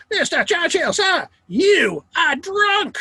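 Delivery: high, loud, slow outraged lady; indignant Nancy Astor. Retold or imitated. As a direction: shriek it like a scandalised society lady. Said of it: "And she is indignant".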